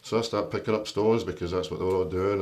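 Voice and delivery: ordinary delivery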